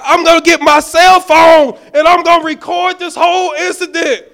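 high, loud threat to film him; angry shout. Retold or imitated. Pastor threatening to record the traffic stop, angry shout. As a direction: yell it, spitting mad. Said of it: "I'm spitting, I'm just angry. I'm on ten".

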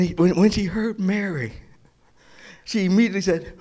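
usual speaking voice

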